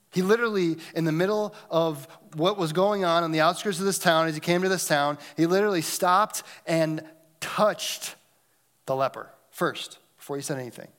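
ordinary talk

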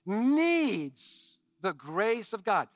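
needs slow and drawn out; stress on needs. Real, not acted. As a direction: lean slowly on needs. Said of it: "I can't emphasize that word enough".

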